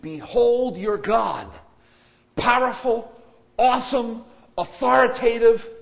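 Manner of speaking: slow, loud, high and ringing; booming proclamation. Acted. Preacher to congregation, a booming proclamation. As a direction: proclaim it like a herald on a mountain.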